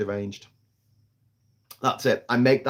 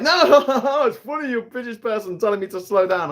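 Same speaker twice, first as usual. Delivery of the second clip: much higher laughing driver voice; mocking driver voice; 9 semitones higher, 3 dB louder than usual. Mocking the British passenger, laughing driver voice. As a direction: play the amused driver, high and loud. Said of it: "And they're like".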